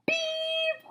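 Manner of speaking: high flat electronic beep; be the lie detector going off; machine beep. A narrator's reading. Lie detector catching the boy's lie, high flat beep.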